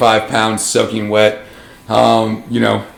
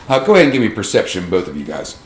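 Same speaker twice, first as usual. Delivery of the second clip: ordinary talk, nothing marked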